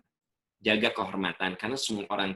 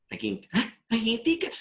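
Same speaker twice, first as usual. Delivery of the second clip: shocked mother; 13 semitones higher than usual. Retold or imitated. Mother reacting to child's rank.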